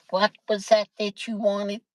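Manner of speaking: lightly put-on American accent. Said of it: "my American accent ain't that good".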